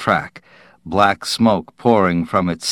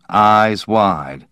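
plain reading voice

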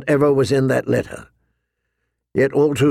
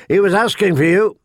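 higher-pitched announcement from a guard; guard announcing; 7 semitones higher, 4 dB louder than usual. A narrator's reading. Guard tells Jon where the old bear is, announcing. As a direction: announce it firmly from your post. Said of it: "one of them announced".

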